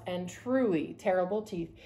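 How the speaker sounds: plain reading voice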